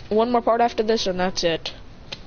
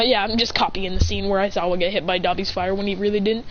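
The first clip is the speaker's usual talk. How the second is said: usual speaking voice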